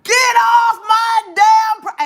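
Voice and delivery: loud, high, drawn-out angry holler; mock furious landowner's shout; yelling old man. Old man with a gun chasing the visitor out, hollering.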